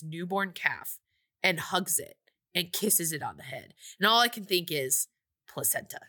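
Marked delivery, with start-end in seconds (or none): 5.5-6.0 hushed, grossed-out aside; whispered punchline. Real, not acted. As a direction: whisper it, much softer. Grossed-out thought about the calf.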